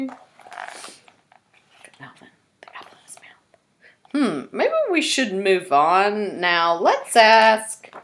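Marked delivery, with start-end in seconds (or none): 1.8-4.5 unvoiced spooky whisper; whispered aside. Reader whispers the monster's joke to the kids. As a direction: hush it like a joke.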